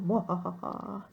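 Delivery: spelled-out mad scientist cackle; evil laugh. Acted. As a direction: gloat with a villain's laugh. Mock evil laugh after plan.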